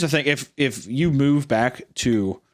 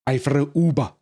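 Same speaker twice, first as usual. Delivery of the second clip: higher, put-on Cuban "OO-ba"; mock Cuban accent; 4 semitones higher than usual. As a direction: play at a Cuban accent, K dropped. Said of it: "but they say Cuba".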